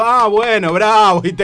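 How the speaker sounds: loud, nervous high praise; nervous stammer. Retold or imitated. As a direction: flatter him nervously, too loud. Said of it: "cuando yo me pongo nervioso empiezo a forrear". (halago nervioso y fuerte)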